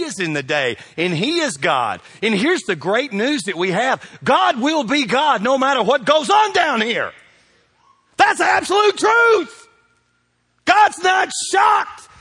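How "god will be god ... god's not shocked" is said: full-force preaching. Real, not acted. Sermon climax about God.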